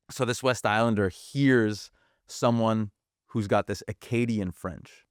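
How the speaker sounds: ordinary delivery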